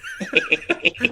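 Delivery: laughing hard at the joke; high squeal of laughter. Real, not acted.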